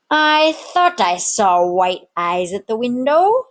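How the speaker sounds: slow, rough tavern keeper; slow barkeep voice. A narrator's reading. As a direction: drawl it out like an old barkeep. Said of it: "Samuel Spoons declared".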